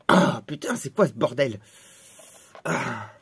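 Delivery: low pained groaning; acted pain. Victim baffled by the spell, pained groan.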